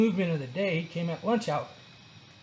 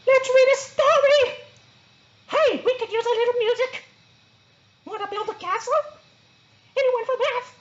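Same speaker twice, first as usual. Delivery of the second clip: very high, loud, eager chirp; perky excited Sparky; 6 dB louder than usual. A narrator's reading. New sloth Sparky rousing classmates, very excited. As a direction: bubble over with energy.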